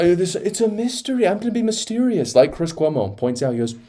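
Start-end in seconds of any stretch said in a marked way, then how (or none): 0.0-2.4 high-pitched mocking coyness; mock mysterious. Host mimics Steve Bannon acting cagey, mock mysterious. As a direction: put on a high, teasing secretive voice. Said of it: "he comes out and he's like".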